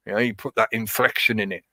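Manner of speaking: ordinary delivery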